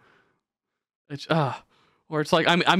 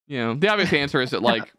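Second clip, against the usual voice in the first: ordinary delivery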